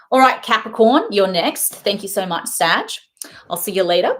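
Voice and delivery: lightly Australian sign-off, cheerful; Australian accent. Real, not acted. A hint of Australian accent, saying goodbye to Sagittarius. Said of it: "my Australian accent's coming out".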